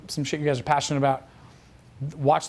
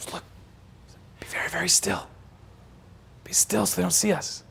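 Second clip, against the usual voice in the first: whispered, urgent command; secretive stage whisper; hushed warning; 3 semitones higher, 5 dB quieter than usual. Retold or imitated. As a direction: warn them while hiding from view. Acted hiding from the light.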